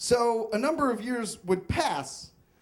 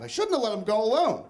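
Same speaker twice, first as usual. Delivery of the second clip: normal talking voice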